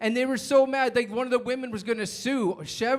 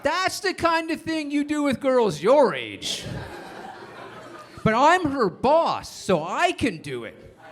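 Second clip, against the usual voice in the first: higher, slow, mock-serious lecture; principal lecturing; 5 semitones higher, slower than usual. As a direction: play the hypocrite principal, slow and pompous. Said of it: "The principal sits me down and goes".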